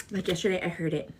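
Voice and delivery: usual speaking voice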